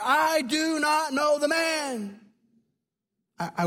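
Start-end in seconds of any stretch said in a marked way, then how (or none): 0.0-2.2 shouted high and slow, swearing it off; cursing denial. Retold or imitated. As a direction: roar the denial like a cornered man cursing. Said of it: "began to curse and swear".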